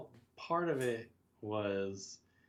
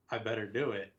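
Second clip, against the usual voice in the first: ordinary delivery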